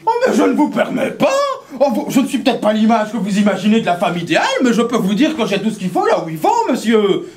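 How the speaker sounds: high, loud indignant lady with a laugh; high, loud laughing protest at the policeman; outraged lady. Acted. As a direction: shriek with offended dignity.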